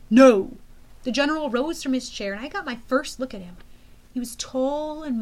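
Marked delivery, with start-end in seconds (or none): 0.1-0.6 very loud single-word refusal; booming refusal. A narrator's reading. General refusing Thorne's request, booming. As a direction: shut him down with force.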